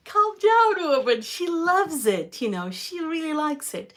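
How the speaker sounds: a hammed-up dad brushing off the panic; imitating dad. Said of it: "And he's like".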